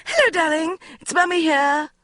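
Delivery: shrill-pitched mother on the phone; high-pitched and hysterical. A narrator's reading. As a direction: trill it out like a frantic mum. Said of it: "She sounded unusually high-pitched and hysterical".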